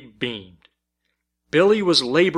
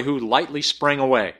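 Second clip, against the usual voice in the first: ordinary delivery, nothing marked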